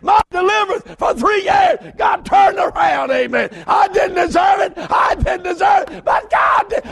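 high shouted preaching; preaching at full force. Real, not acted. Preacher shouting his own deliverance to the church. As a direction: lift the church with each line.

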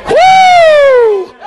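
high, loud joyful woo; exultant whoop. Real, not acted. Preacher whooping after his own point, exultant whoop. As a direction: let out a shout of joy. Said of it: "that helped me".

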